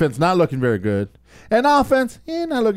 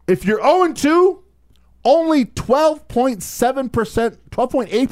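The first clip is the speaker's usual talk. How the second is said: emphatic; 8 semitones higher than usual. Real, not acted. Stat pitch, emphatic.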